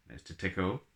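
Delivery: ordinary delivery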